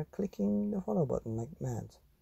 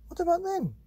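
put-on child's voice; 4 dB louder, faster than usual. Child answers parents.

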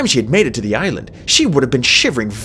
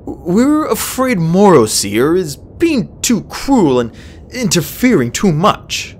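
put-on slow, hesitant young dragon; 5 semitones higher, 3 dB louder, slower than usual. A narrator's reading. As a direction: ease into it carefully. Starflight continues hesitantly.

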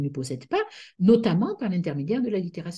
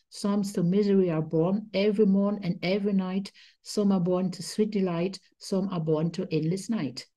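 rhythmic recital with a Germanic accent; poem recitation. Shown as a demonstration. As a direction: declaim the poem so the stresses carry it like music. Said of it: "le début c'est comme ça".

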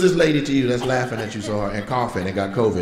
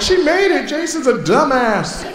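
much higher, laughing mock wife voice; wife impression; 13 semitones higher than usual. Comic voicing the wife laughing at her husband. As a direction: squeak it out as the gleeful wife.

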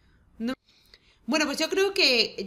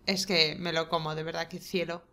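tender cooing; 6 semitones lower, 4 dB quieter than usual. Real, not acted. Cooing at sleeping man.

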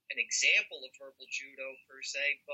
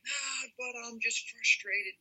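higher, drawn-out mock frustration; exasperated; 8 semitones higher, slower than usual. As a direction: sigh it out slowly as a frustrated complainant. Acted reply of upset person.

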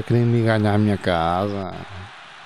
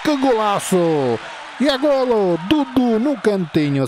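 explosive goal commentary; 11 semitones higher, slower than usual. Acted. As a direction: shout it like a commentator. Goal commentary during play.

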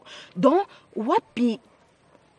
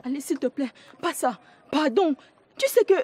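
high, urgent begging; panicked pleading; 7 semitones higher than usual. Acted. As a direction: beg her desperately not to tell. Begs Alice not to tell.

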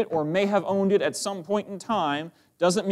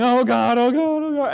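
high, loud pleading "oh God"; desperate wailing plea; panicked cry; 6 semitones higher, 6 dB louder than usual. Acted. People crying out to God.

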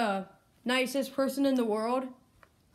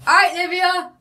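calling out; 6 semitones higher, 7 dB louder than usual. Real, not acted. Seeker calling out to hider.

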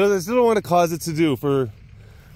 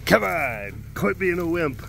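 taskmaster; 4 dB quieter, slower than usual. Acted. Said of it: "taskmaster for our new intern".

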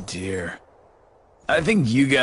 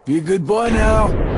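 higher, louder mocking order, leaning on now; taunting command; 6 semitones higher, 7 dB louder than usual. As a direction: talk down to him like a child. Villain taunts before attack.

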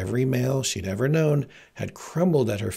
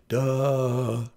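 lightly voiced slow dullard, drawn-out vowel; a mild mock dimwit; dim-witted Wesley. Narrator mocks what Wesley would have said, lightly dim-witted. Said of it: "when he was feeling really sharp".

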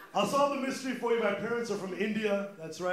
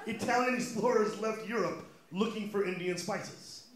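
ordinary talk, nothing marked

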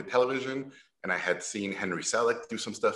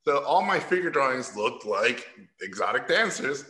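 ordinary delivery

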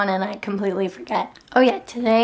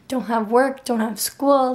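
ordinary delivery

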